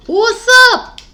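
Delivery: high excited squeak of a hello; squealed greeting. Acted. Tarot reader squealing hello at her viewers. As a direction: squeal it out like a giddy kid.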